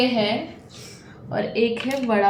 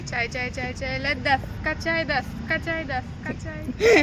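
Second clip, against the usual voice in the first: tea vendor call; 2 semitones higher, 4 dB quieter than usual. Pretend. Mimicking a street tea vendor's call.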